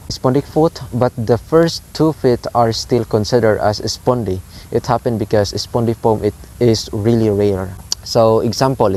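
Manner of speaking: ordinary delivery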